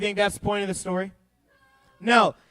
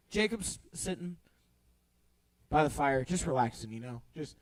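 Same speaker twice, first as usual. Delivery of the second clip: much softer and lower, easy and unhurried; relaxed fireside hush; 9 semitones lower, 11 dB quieter than usual. Acted. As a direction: settle in like dozing by a fire. Relaxed Jacob by the fire.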